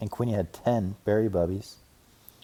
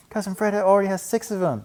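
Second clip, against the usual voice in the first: put-on little boy voice; 12 semitones higher, 7 dB louder than usual. A narrator's reading. Brother cub voice.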